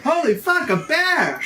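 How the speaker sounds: high shocked outburst; startled yell. Acted. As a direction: blurt it out in shock. Surprised yell at a bear.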